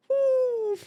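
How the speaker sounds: usual speaking voice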